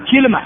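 كلمة as kilma; shifted vowel. Shown as a demonstration. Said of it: "ثلاث لغات". (كلمة بكسر الكاف وتسكين اللام)

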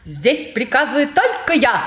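a hint of a grand lady giving orders; playing a marquise to her stepmother, mildly majestic. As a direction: lightly play the haughty marquise. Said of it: "величественно произнесла маркиза".

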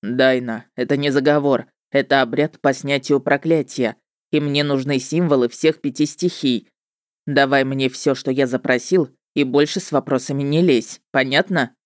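low male voice laying down orders; firm witcher. A narrator's reading.

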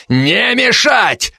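slow, sharp, high bark; barked order. Acted. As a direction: bark the command to stop them. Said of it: "рявкнул неожиданно Бессмертнов".

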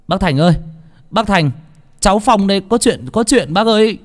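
high-pitched call at the door; urgent calling out. A narrator's reading. Phong knocks for village chief Thành, urgent calling out.

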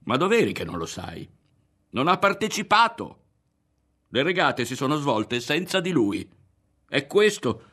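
high, loud incredulous outburst; worked-up character in a quarrel; agitated exasperation. A narrator's reading. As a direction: argue heatedly, pitch leaping upward. Exasperated argument over a defeat.

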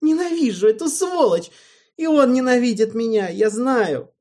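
loud, high raging shout; furious yelling. A narrator's reading. Alvin yells his hatred at Erald, furious. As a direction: spit out the hatred at full voice.

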